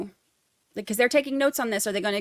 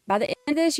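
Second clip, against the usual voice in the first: ordinary talk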